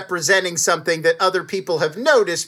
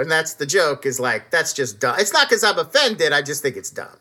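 usual speaking voice

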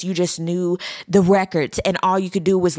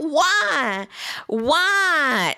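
high, stretched-out mock cry; mock dramatic wail; 6 semitones higher than usual. She runs out after him storming off, mock dramatic wail. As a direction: ham up a drawn-out plea. Mocking the dramatic running-out scene.

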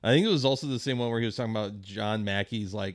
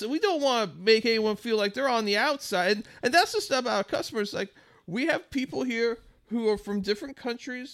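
high, gentle hippy boss voice; sweet hippy manager; 10 semitones higher than usual. Retold or imitated. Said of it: "old hi-, sweet hippy dippy guy".

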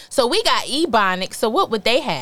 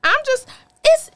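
Southern belle; 2 semitones higher than usual. Pretend. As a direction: play a flustered Southern belle, drawling. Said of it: "Didn't I sound like a Southern belle?".

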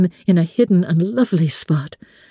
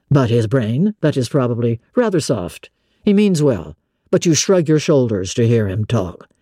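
low male voice, scoffing; 7 semitones lower than usual. A narrator's reading. Master jealously mocking the cousin, low and scornful. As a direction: lower gentleman, jealous and mocking.

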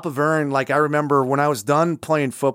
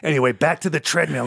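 ordinary delivery

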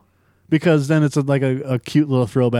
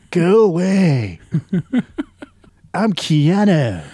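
drawn-out, slow, laid-back dismissal; slow Keanu impression; 3 semitones higher, much slower than usual. Podcaster mocking a bar scene with a slow Keanu brush-off. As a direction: drag out each word like a bored movie star. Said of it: "And be like".

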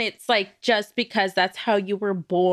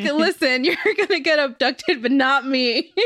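laughing; 3 semitones higher than usual. Retold or imitated. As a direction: giggle through the soul's words. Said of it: "My soul was like".

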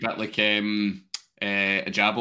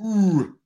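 high, drawn-out "ooh"; an ooh at a juicy fixture list; impressed ooh; 8 semitones higher, 4 dB louder than usual. Acted. Host acting a fan looking at the slate, impressed ooh. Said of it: "you go".

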